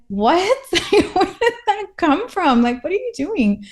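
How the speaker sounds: high, laughing disbelief; mock bewildered. Reacting to her brother's random remark, high amused disbelief. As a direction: squeal a puzzled what with a laugh. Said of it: "randomly blurt out something that it's like".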